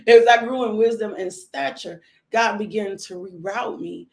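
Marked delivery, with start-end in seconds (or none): 0.1-2.0 louder, with a laugh in the voice; laughing restart. Real, not acted. Speaker restarting the line after a laugh, laughing. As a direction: pick the phrase back up through laughter.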